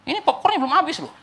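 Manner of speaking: high-pitched, comically aggrieved voice; mock complaint. Moviegoer with unfinished popcorn to the shouter, mock complaint. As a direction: whine in comic protest.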